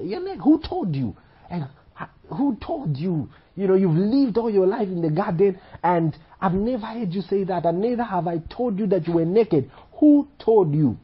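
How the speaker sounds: high-pitched mock-incredulous God; incredulous questioning. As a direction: retell a disbelieving interrogation, pitched high. God questioning Adam in retold scene.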